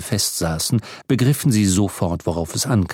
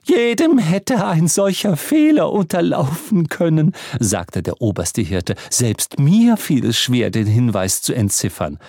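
lofty chief priest; 6 semitones higher than usual. Acted. Chief priest voiced distinctly.

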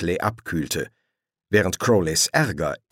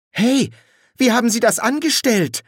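high, loud, startled shout; astonished call; 5 dB louder than usual. A narrator's reading. Injured stranger calls from the ditch, astonished. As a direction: call out in amazement.

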